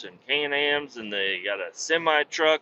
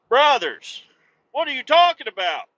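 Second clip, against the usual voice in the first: much higher, louder put-on voice; mock incredulous reply; 11 semitones higher, 6 dB louder than usual. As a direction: act baffled and exaggerated. Imagined reply to guru podcasters.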